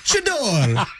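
high laughing Italian fan voice; hammed-up Italian accent, giggling; mock Italian accent.

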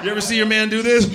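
ordinary talk, nothing marked

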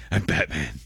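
lightly done gruff superhero; Batman. Acted. Host corrects co-host's impression, lightly voiced superhero. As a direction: do a hint of the masked vigilante. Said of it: "Batman is".

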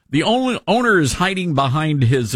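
ordinary delivery

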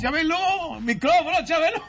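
high playful call. Pretend. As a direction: shout the name up high. Calling out Chabelo to mic.

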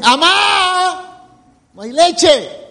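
put-on high child's call of Amá; child calling mom. Preacher imitating a child calling mom about the milk, a light child voice.

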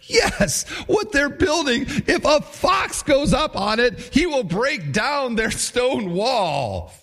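hammed-up heckling jeer; mocking Tobiah. A narrator's reading. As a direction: yell the taunt like a heckler. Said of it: "Tobiah the Ammonite ... he said".

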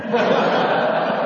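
high, airy laugh; real high giggle. Real, not acted. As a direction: let a high laugh escape. Laughs at the punchline.

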